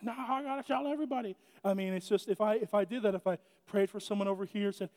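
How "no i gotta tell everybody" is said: eager crowd voice. Retold or imitated. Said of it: "they're just like".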